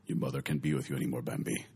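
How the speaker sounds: very low, quiet, solemn stag; Bambi's father voice. Retold or imitated. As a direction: drop deep and grave. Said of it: "that Bambi's dad".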